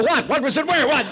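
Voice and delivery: high, jumpy rapid questions; hammed-up startled confusion; mock panic. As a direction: act rattled and searching all around. Acts his jumpy reaction to son.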